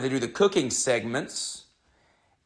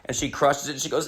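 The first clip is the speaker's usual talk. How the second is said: ordinary talk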